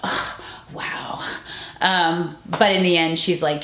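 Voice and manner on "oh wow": breathless hushed aside, barely voiced; awed whisper. Assistant watching Judy backstage, hushed whisper.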